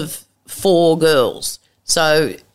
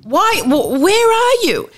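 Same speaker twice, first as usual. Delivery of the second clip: exasperated shout. Retold or imitated. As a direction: yell into a crackling phone. Shouting into bad phone line.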